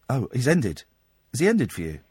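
normal talking voice

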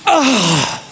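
high yelled serve grunt; effort grunt. Acted. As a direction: grunt as if serving hard. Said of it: "that wasn't just a grunt".

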